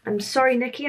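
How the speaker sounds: ordinary talk, nothing marked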